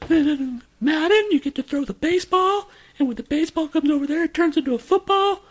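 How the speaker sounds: very high, loud, put-on squeaky voice; mock dumb explanation. Mocking the friend's Magic explanation in a high silly voice. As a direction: squeak it out like a clueless kid.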